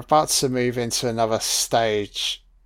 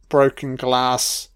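ordinary talk

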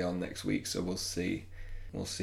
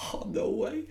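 retold surprise. Retold own reaction.

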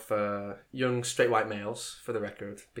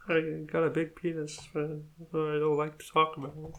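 mocking put-on voice, higher; Connor; 5 semitones higher than usual. Host mocks absent friend on the show, bad impression. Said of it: "That's a horrible impression of Connor".

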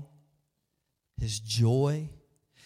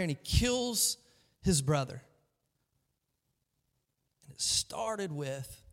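normal talking voice

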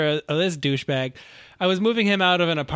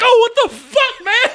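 startled man; 9 dB louder than usual. Retold or imitated. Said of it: "And he's like".

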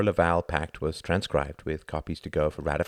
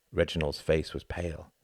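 ordinary delivery, nothing marked

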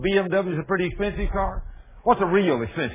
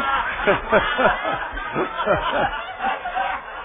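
hearty laugh; 10 semitones higher than usual. Real, not acted. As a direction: burst out laughing. Laughing at own story.

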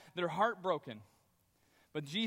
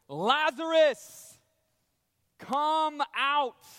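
loud, slow, higher command; commanding shout; 6 semitones higher, 9 dB louder, much slower than usual. Acted. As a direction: call him out of the tomb with full authority. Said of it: "Jesus shouts with authority".

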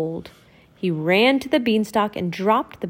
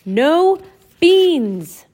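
high, louder warning call; calling after him; 8 semitones higher, 7 dB louder than usual. Acted. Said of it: "called Donald".

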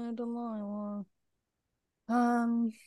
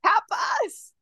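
excited; 12 semitones higher, 5 dB louder than usual. Real, not acted. Gasp then delighted shout.

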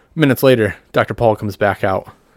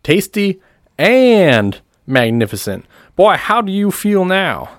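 high, slow, loud flourish; showman; 10 semitones higher, 4 dB louder, slower than usual. Acted. Doctor Paul showing off the boy who drank, grand showman. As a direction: present the miracle with drawn-out relish.

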